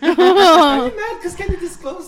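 loud laughing tease; laughing loudly. Real, not acted.

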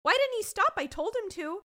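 mock-distraught, very high; high wailing imitation of bewildered panic; panicked. Monique after watching the car explode, imitated high panic. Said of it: "Her panic at first".

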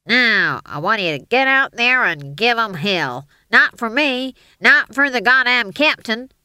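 loud, gruff American war-film officer; rasping army sergeant. A narrator's reading. Stage manager suddenly playing army commander, loud rasp. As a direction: growl a pep talk round a cigar.

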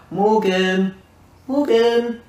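high singsong summons, drawn out; calling a cat. Retold or imitated. Friend calling up the staircase for Morgan, singsong cat call. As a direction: coax him out like a pet at dinnertime. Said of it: "like I'm holding a cat for its dinner".

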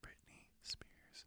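slow unvoiced whisper; whispering a secret password. Real, not acted. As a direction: breathe the answer into the mic.

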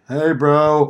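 lightly voiced stoner greeting; a hint of a stoner dude. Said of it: "Dude's a total stoner".

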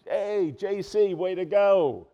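high, loud, slow cheer. Pretend. Imagined disciples cheering Jesus after the rescue, loud and high. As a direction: whoop it like a buddy high-fiving.

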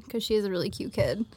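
ordinary talk

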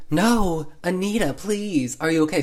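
high-pitched mock pleading; acted pleading. B pleads with Anita as she hisses, mock-anxious pleading. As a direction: imitate an anxious friend begging, pitched well up.

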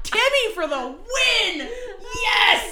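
high, loud, cheering through laughter; laughing cheer. Real, not acted. Cheering the dad's line while laughing hard. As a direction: cheer for the dad while cracking up. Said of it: "cheering while laughing".

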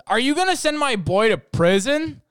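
loud, high accusing yell at the managers; a furious mother demanding answers; mom's outraged shout. Retold or imitated. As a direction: mom yelling at the store men over her son. Mom confronts store managers.

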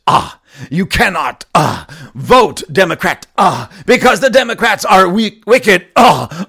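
building to a loud, high preacher shout; mock revival preacher. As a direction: punctuate each phrase with a preacher's "ah". Slips back into parodied shouting preacher.

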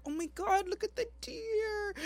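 high, soft, slow cooing girlfriend imitation; imitating girlfriend. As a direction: coo it softly and high like an awed girlfriend. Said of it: "she was like".

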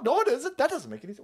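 ordinary talk

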